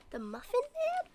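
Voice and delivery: soft squeaky mock question; quiet high question.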